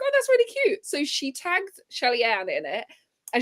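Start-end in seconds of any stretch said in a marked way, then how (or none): 0.0-0.8 very high, sweet coo. Retold or imitated. Host reacting to a cute crying post, high cooing. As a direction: coo at something adorable.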